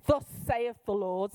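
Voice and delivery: ordinary delivery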